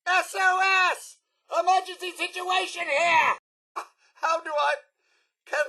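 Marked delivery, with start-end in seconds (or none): 0.1-3.4 a robot yelling for rescue in a panic; alarmed distress call. Acted.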